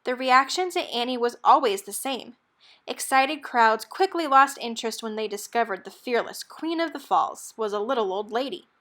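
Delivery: plain reading voice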